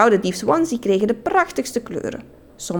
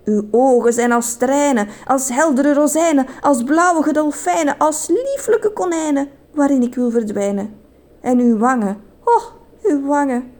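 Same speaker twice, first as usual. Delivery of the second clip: high, breathless-with-love mock declamation; hammed-up lovestruck poet; passionate; 6 semitones higher than usual. A narrator's reading. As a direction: rhapsodise grandly, pitched up, lingering on the images. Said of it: "ging vol passie verder".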